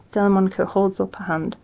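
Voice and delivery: ordinary delivery, nothing marked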